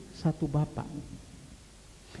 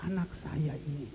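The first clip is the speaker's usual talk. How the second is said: hushed, softer retold confiding; lightly lowered voice, as if confiding; retold whisper; 5 semitones lower, 7 dB quieter than usual. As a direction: quietly quote a mother's whispered confidence. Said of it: "dia bisik sama saya".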